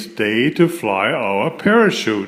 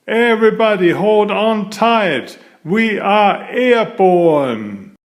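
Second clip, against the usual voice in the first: higher, urgent call to hold on; excited warning; 7 semitones higher, 3 dB louder than usual. Acted. The narrator warns friends as they lift off, higher and excited. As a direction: shout a thrilled alarm, slowing on airborne. Storm lifts the parachute car.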